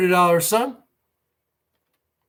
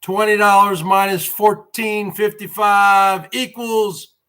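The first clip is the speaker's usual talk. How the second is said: ordinary delivery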